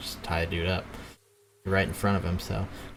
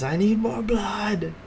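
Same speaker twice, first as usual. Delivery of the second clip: high, drawn-out strained cry; muted mock scream; 12 semitones higher, much slower than usual. Bound captive struggling on the ground, muted scream. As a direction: stretch it out in a high, held-back scream. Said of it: "struggling on the ground and screaming".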